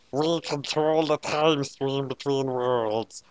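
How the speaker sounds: slow solemn otherworldly intro; slow Shinigami voice. A narrator's reading. Shinigami introduces herself to L, slow solemn voice. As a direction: announce yourself slowly, ominous.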